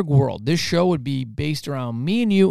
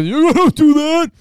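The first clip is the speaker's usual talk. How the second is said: high, slow, put-on protest; mock accusing voice; 12 semitones higher, 7 dB louder, much slower than usual. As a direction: drag it out high like a whiny protest. [name] voicing Jody's protest.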